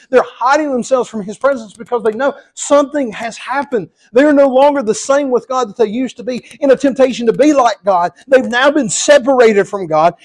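loud, rising preacher's fervour; full-force preaching. Acted. Preacher driving home his point to the church, loud and fervent. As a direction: preach it hard to the congregation.

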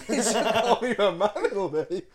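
high, loud, laughing through the words; cracking up while telling it; laughing hard. Real, not acted. Telling friends about being scolded, laughing loudly.

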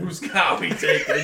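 put-on slow Boston accent with a laugh. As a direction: drawl it in a thick Boston accent. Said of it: "In the best Boston accent ever".

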